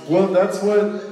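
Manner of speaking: ordinary talk, nothing marked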